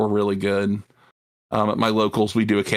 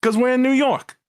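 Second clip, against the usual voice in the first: high New York gangster voice; 1930s minion voice; 12 semitones higher than usual. Acted. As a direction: play an old-timey mob flunky. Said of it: "minion voice".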